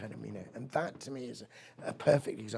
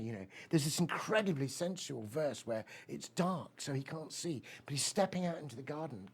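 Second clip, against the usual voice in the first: usual speaking voice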